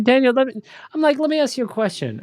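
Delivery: high teasing setup, imitating himself; mock interrogator. Scene: teasing the candidate about his grandfather, high mock gravity. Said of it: "I said, I said".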